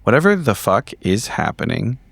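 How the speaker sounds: ordinary talk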